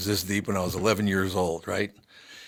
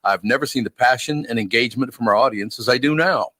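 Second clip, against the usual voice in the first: upbeat sponsor read, a touch louder; 5 dB louder than usual. Acted. Host pitching businesses in an upbeat ad read. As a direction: sell the listeners on the partner program.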